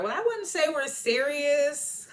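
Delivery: high, drawn-out, put-on voice; coy denial. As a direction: stretch it out slowly in a high voice. Acts Hillary's coy denial.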